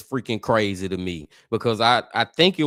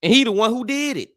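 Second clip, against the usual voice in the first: incredulous; 10 semitones higher, faster than usual. Real, not acted. Incredulous punchline.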